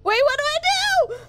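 high, panicked plea. Real, not acted. Streamer begging chat for help, high panic. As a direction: blurt it out in sudden high panic. Panicking at horror game monster.

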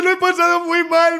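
usual speaking voice

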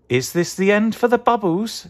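high-pitched questioning character; high, curious story voice; voiced pineapple. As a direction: ask in a high little character voice. Pineapple asks another in story.

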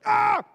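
a high, loud startled yelp; sudden high shout, caught off guard; sudden cry. Retold or imitated. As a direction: blurt out a sharp cry. Playing off the sudden outburst.

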